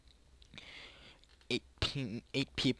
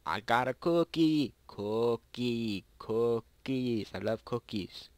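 silly voice; 4 dB louder, slower than usual. Pretend. Silly voice about cookies.

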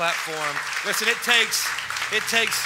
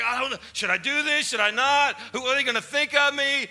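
inner voice of doubt; 7 semitones higher, 4 dB louder than usual. Retold or imitated.

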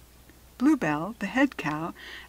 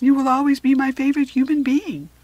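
high, loud, heartfelt proclamation; sweeping, emphatic tribute to a friend; fond declaration; 6 semitones higher, 9 dB louder than usual. Acted. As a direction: declare affection at full voice. Wiz's fond farewell declaration.

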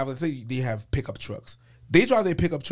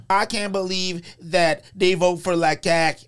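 mocking city dweller; 6 semitones higher, 4 dB louder, slower than usual. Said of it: "you talking about".

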